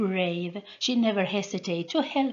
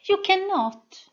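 high, louder smooth repeat; 11 semitones higher, 6 dB louder than usual. A narrator's reading. Sol repeating her taunt louder and higher. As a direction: press it home, smooth and high. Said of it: "with a smooth voice".

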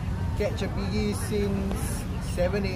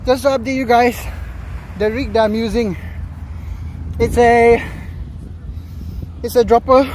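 loud, breathless after a hard fight with a fish; panting out words after the fight, louder; out of breath, loud; 4 semitones higher, 5 dB louder than usual. Real, not acted. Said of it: "I'm out of breath".

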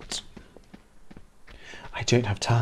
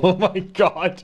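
laughing; 7 semitones higher, slower than usual. Real, not acted. Laughing at the chat.

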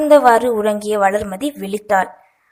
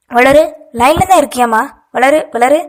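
louder, insistent calling down the phone; concerned, raised-voice check on the line; 3 semitones higher, 7 dB louder than usual. A narrator's reading. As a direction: call to get an unresponsive listener's attention.

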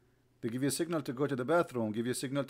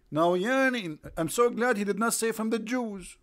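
ordinary talk

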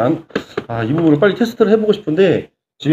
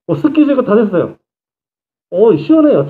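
excited; 6 semitones higher, 3 dB louder than usual. Real, not acted. Mask fog cleared, fan cooling.

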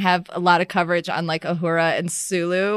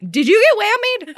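high mock concern; 11 semitones higher, 4 dB louder than usual. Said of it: "They're like".

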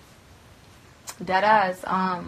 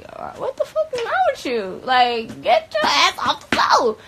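much higher, louder, shouting in disbelief; disbelieving yell; 10 semitones higher, 4 dB louder than usual. Retold or imitated. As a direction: yell at him to get up off the floor. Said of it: "I was looking at him like".

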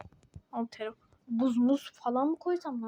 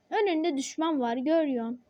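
ordinary delivery

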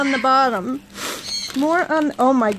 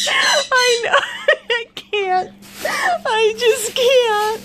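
high, squeaky words through laughter; helpless laughter; 9 semitones higher, slower than usual. Real, not acted. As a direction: laugh until you can barely speak. Helpless laughing fit at gift.

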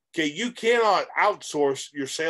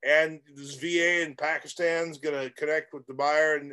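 ordinary delivery

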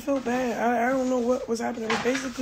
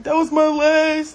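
slow, loud, high crying wail; imitating crying; 5 semitones higher, 9 dB louder, slower than usual. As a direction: mimic a sobbing plea. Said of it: "he was really crying".

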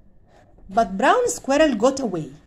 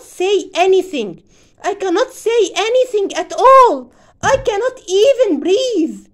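pleading squirrel; 7 semitones higher, 6 dB louder than usual. Acted.

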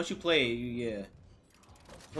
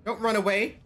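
panicking gamer; 7 semitones higher, 5 dB louder than usual. Real, not acted. Frantic orders to companion.

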